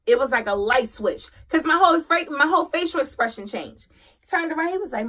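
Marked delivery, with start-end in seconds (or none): none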